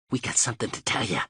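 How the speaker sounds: fast, hushed menace; low and quick. A narrator's reading. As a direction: rattle it off under his breath. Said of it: "talking low and quick".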